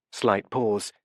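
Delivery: ordinary delivery, nothing marked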